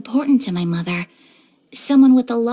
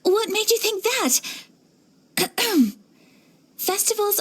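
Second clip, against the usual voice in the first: much higher, stammering flustered protest; flustered denial; 9 semitones higher, 3 dB quieter than usual. Acted. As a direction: caught out, deny it too fast.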